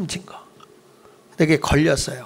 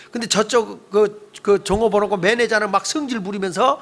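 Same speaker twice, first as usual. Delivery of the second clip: ordinary delivery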